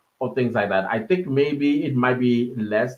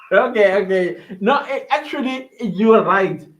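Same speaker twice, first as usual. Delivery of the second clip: laughing; 6 semitones higher, 5 dB louder than usual. Real, not acted. Laughing at viewer's spelling correction.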